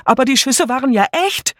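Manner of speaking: very high, frightened cries; confused panicked calls. A narrator's reading. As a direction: call out in a rising panic, wailing at the end. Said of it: "alle riefen wirr durcheinander". (panisch durcheinander gerufen)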